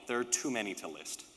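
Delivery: ordinary talk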